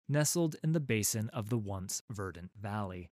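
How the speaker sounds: ordinary delivery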